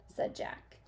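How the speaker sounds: ordinary delivery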